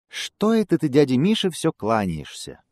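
high, bright squirrel call. Acted. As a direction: call down from a tree. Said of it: "Белка ему с дерева кричит".

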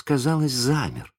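usual speaking voice

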